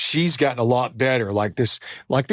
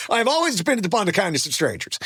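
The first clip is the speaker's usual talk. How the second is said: quoted film line; 6 semitones higher than usual. Acted. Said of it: "Remember that from Streetcar Named Desire".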